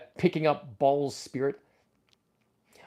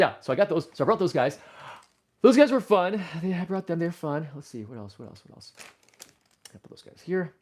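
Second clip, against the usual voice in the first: ordinary talk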